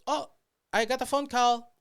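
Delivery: high, loud put-on voice; imitated coworker. Coworker interrupting the driver's story, high loud mock voice. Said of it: "And then she goes".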